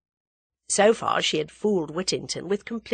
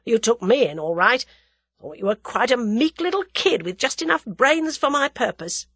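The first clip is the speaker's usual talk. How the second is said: lightly voiced crook, raised tone; grudgingly amused crook; 6 semitones higher, 4 dB louder than usual. A narrator's reading. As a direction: concede with rueful admiration that you were fooled.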